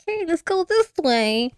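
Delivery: high coaxing voice; playful coaxing. Retold or imitated. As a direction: lure a little one along, lightly acted. Retold telepathic coaxing of baby monster.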